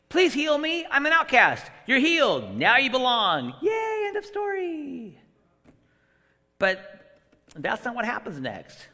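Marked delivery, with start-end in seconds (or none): 0.1-3.5 higher and louder, hammed-up; acted leper and Jesus. A preacher mocks an easy healing story, hammed-up and loud. 3.6-5.2 high, slow sarcastic yay; mock cheer. Preacher mocking a too-easy ending, high sarcastic yay.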